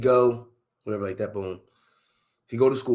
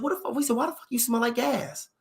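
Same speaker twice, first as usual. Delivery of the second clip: high-pitched, exasperated questioning without shouting; imitated baffled dad; 9 semitones higher, 5 dB quieter than usual. Imitating dad puzzled by son's gas smell, high and exasperated. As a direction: mimic a father who can't get over it.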